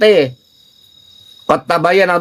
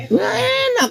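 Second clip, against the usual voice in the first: mock answer; 5 dB quieter, slower than usual. Shown as a demonstration. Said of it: "Enak gak gitu?".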